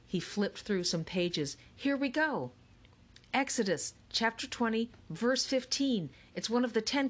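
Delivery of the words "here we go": plain reading voice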